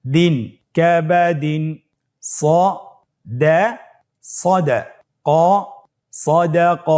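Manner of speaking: syllable by syllable, then joined; even, level-toned teaching recitation; broken into syllables. Shown as a demonstration. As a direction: break the word apart and build it up piece by piece. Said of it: "ভেঙে ভেঙে পড়ব".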